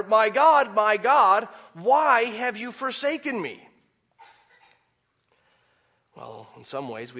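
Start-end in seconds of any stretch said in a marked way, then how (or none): 0.0-3.7 loud, high-pitched plea to God; preacher's impassioned imitation of a forsaken cry. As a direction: re-enact Jesus crying out from the cross. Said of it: "Jesus cried out".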